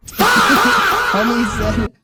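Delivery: loud burst of laughter, drawn out slowly over the words; cracking up at the clip; laughing out loud. Real, not acted.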